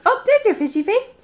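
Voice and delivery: light up at the fish face; delighted. Real, not acted. Mother delighted to see her toddler's fishy face, high.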